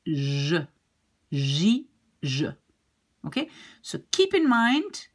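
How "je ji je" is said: "je, ji, je" with a buzzing zh; soft zh opening each of "je, ji, je"; soft French G. Shown as a demonstration. Teacher shows G before those letters, soft zh. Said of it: "you will pronounce it like".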